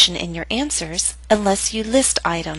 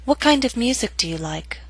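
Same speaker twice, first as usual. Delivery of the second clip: "What kind of music do you like?" high, falling to the end; falling intonation; 4 semitones higher than usual. Shown as a demonstration. Teacher models practice question, falling intonation. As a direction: start high, step down to "like". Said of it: "I'll pause to give you a chance to read".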